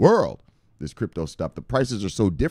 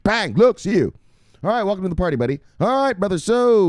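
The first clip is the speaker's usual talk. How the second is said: hype shoutout; 9 semitones higher, 5 dB louder than usual. Acted. Shoutouts to viewers.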